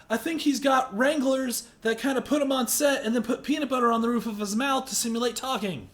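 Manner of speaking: normal talking voice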